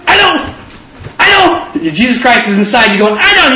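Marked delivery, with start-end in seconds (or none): 0.1-1.9 high, teasing refusal; mock refusal. Jesus inside you teasingly refusing, in a high voice. 3.2-3.5 high, teasing refusal; mock refusal. Jesus inside you teasingly refusing, in a high voice.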